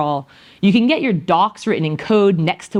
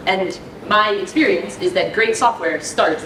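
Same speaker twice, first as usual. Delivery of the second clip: normal talking voice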